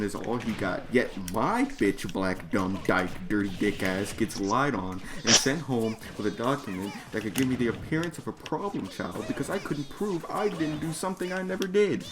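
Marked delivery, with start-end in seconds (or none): none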